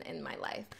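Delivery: ordinary talk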